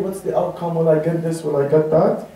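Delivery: low, worried muttered questions; mock fearful. Preacher mimics man afraid before an official, timidly anxious. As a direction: imitate someone fretting over the outcome. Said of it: "by being afraid".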